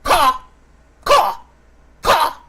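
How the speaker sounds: loud high crow cawing; shrill bird boss cawing over the talk; loud crow caws. Acted. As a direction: caw at them like an angry crow. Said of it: "He just starts cawing at you".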